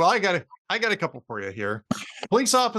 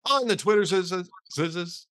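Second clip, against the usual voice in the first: normal talking voice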